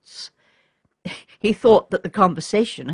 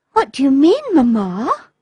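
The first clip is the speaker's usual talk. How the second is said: put-on high, louder astonished little girl; 6 semitones higher, 5 dB louder than usual. A narrator's reading. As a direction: ask it like a startled young daughter.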